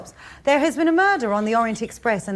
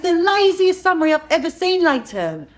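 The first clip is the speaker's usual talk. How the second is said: scolding; 8 semitones higher, 3 dB louder than usual. Real, not acted. Mother scolding son over homework.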